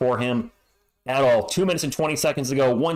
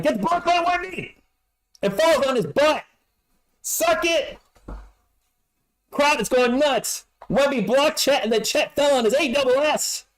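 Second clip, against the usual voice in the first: far higher, gleeful shouting; excited yell; 11 semitones higher than usual. Real, not acted. Fan calling a block on his rival, gleeful yelling. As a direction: gloat over the big block. Wemby blocks Chet, crowd nuts.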